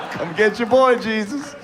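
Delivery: high-pitched comic plea; hammed-up begging for rescue; mock pleading. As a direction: squeak out a desperate appeal. Repeats the plea, higher.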